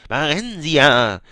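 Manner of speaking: long, swelling chant of Barensia; drawn-out incantation. A narrator's reading. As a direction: cry the name out like a spell. Wie die Beschwörung eines Zaubers.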